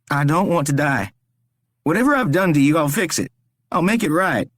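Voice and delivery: high, desperate begging; pleading captive Rory. A narrator's reading. Rory begs the stranger to spare him, desperate.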